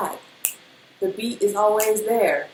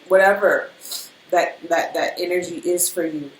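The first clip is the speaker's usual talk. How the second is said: ordinary delivery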